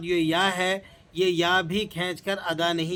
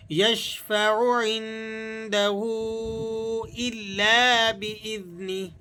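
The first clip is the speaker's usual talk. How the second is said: slow, drawn-out chanted recitation; measured melodic Quran reading; 3 semitones higher, much slower than usual. Shown as a demonstration. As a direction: recite with tajweed, stretching the vowels.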